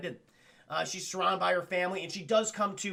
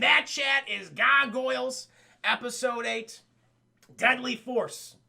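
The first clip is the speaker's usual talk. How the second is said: slow, raised, emphatic title announcement; grand announcer sign-off; 5 semitones higher, 5 dB louder, slower than usual. Pretend. Recapper closes the episode for chat, grand announcer sign-off. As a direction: spell out the title slowly like an announcer.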